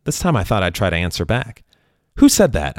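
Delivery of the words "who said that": mild yell upward; startled call to the sky. A narrator's reading. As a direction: shout up at the sky, a little louder. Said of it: "I yelled up at the sky".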